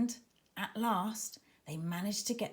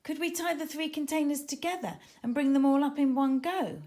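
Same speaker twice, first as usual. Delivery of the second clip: eager suggestion; 7 semitones higher, 5 dB louder than usual. Acted. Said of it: "suggested Tara".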